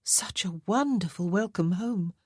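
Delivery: ordinary delivery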